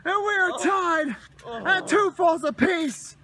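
high, shouted call, slowing on the result; elated play-by-play. Real, not acted. Commentator calls the tying fall, excited shout. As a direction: announce the tap-out in amazement, drawing it out.

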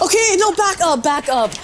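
high panic easing slightly; panicking. Real, not acted. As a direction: blurt it in a fluster, backing off. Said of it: "I'm panicking".

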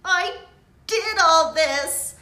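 high, loud and slow despairing cry; upset outburst. A narrator's reading. Lily crying over the spell book, upset outburst. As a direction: wail it out in frustration. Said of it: "getting more and more upset".

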